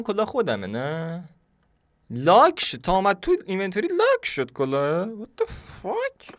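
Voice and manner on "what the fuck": very high, slow stretched disbelief; shocked. Real, not acted. Gamer finds his hat locked, high stretched shock.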